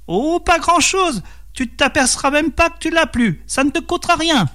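very high, sly snake voice; coaxing devil serpent. A narrator's reading. Serpent tempting peasant into a pact, high coaxing voice. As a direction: play down the price in a high creature voice.

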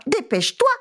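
Dépêche-toi mewed high and urgent; high mewing cat hurry. Acted. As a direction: urge the mouse like a mewing cat. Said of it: "miaulait le chat".

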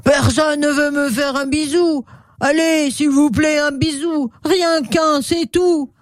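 high-pitched pleading creature voice; whining storybook crocodile; crocodile's plaintive plea. Acted. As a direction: beg for just one kiss, voice pushed high. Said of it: "Une grosse voix re-, retentit".

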